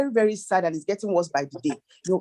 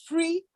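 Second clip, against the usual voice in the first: "free" leaned on, higher than her usual; stress on free; 10 semitones higher, 4 dB quieter than usual. Real, not acted. Planner to host, stressing "free" advice firmly. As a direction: give the word "free" a firm push. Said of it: "and I emphasize the word free".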